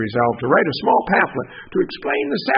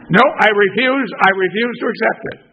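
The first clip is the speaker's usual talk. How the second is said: louder, quick emphatic no; firm refusal; 6 semitones higher, 8 dB louder than usual. Acted. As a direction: flatly refuse the letter. Refusing the postage due.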